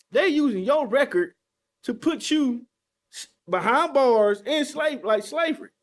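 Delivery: loud, impassioned denunciation from the pulpit; full-force preaching. Real, not acted. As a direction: preach it loud, rousing the people to wake up.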